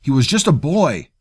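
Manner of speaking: loud, high anguished outburst; angry protest. A narrator's reading. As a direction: shout it in outrage. Angry quarrel over the boy.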